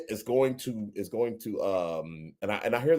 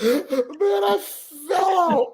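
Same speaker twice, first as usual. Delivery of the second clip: high-pitched, laughing words; helpless laughter; much slower than usual. Real, not acted. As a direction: barely get it out through laughter. Helpless laughter at own story.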